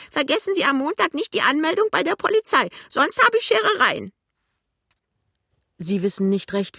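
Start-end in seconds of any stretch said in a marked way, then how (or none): 0.1-4.1 sudden high, loud, fast warning; clear call. A narrator's reading. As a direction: call it out sharply and distinctly. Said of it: "Plötzlich ruft die Alte klar und deutlich". (laut, hoch und deutlich gerufen)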